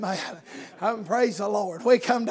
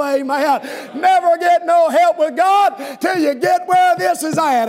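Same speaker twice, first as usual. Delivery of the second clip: rhythmic sermon delivery building to a shout; fired-up revival preacher driving to a climax; preaching; 4 semitones higher, 4 dB louder than usual. Real, not acted. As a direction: preach it with mounting force. Preaches at full force.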